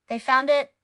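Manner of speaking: plain reading voice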